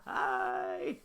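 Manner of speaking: high mock wail, dropping on the last words; retold scream. Viewer screaming at the film over lost money, mock anguished wail. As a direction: wail it out high, half laughing. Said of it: "I screamed. I said".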